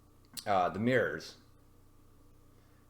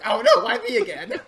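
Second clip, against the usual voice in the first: high, loud laughing whine; hammed-up self-pity through laughter; whiny boyfriend; 12 semitones higher, 10 dB louder than usual. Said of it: "He's like".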